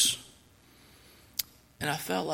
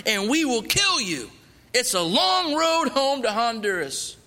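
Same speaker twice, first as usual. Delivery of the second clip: menacing threat; 6 semitones higher, 4 dB louder than usual. Retold or imitated. Robber warning the tied-up captives not to report them, menacing. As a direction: lay down the threat, each line harder.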